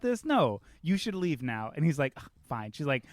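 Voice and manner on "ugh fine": sulky grumble. Retold or imitated. Captain's sulky reply, retold.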